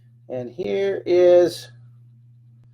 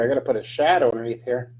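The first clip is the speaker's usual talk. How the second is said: ordinary delivery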